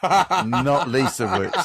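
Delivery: slow words through laughter; laughing. Real, not acted. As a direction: laugh while speaking. Laughing at compliment.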